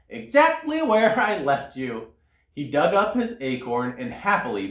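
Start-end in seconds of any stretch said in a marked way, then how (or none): 0.1-2.1 higher, lightly voiced squirrel; pleased squirrel voice. A narrator's reading. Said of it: "said to his hat".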